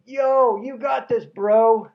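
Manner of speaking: imitated bro cheer, loud and high; yelled encouragement. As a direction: holler support like a buddy in the crowd. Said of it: "But Nino yells out".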